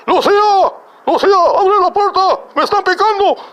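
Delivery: very high, loud Spanish shriek; hammed-up cry for help; mock panicked yelling. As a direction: imitate a man screaming to be let in. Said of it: "And he's yelling".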